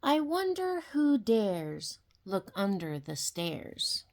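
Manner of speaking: ordinary delivery, nothing marked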